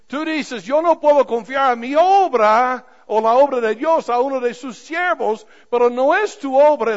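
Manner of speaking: loud, driving pulpit voice; full-force preaching. Real, not acted. Pastor urging his congregation to trust their workers, preaching loudly. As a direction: push it out to the back pews.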